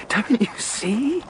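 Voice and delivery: high, rapturous and giddy; gleeful exultation. Acted. Murderer exulting to Walter over their perfect crime, high and rapturous. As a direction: savour the glory of the crime. Villain exults in his plan.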